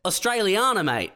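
imitating a caller's broad Aussie accent; Australian accent. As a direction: ham up the Aussie twang. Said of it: "He's like".

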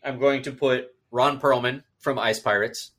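ordinary talk, nothing marked

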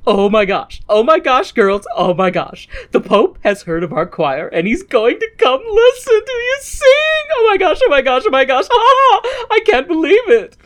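mock thrilled priest squealing over the Pope; giddy excited priest. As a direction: gush with breathless joy. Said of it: "the priest comes in and is like".

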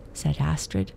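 ordinary delivery

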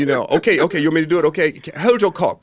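high and loud, crude demands; pushy English director. Retold or imitated. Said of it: "there is a director from England".

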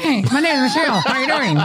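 put-on high, laughing girly voice; mock impression of S0. Mocking her friend's voice to her face, high and giggly. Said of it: "your impression of my voice".

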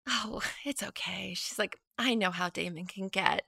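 soft, half-whispered retold reassurance; hushed reply. Ex-girlfriend accepting her apology, hushed.

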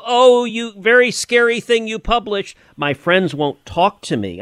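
high whiny mock sob, lightly voiced; hammed-up childish whimper; mock weeping journalist. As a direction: mimic a frightened crybaby reporter. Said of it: "weeping of".

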